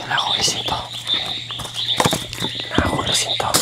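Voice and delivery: soft unvoiced whisper. Real, not acted. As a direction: breathe the words, no voice. Quietly removing it, scared.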